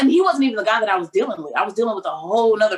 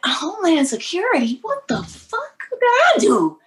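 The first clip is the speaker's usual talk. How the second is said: high, slow, stunned outrage; high and slowed, dragging the outrage; shocked disbelief; 11 semitones higher than usual. Retold or imitated. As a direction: act floored by the arrest. Stunned at being detained.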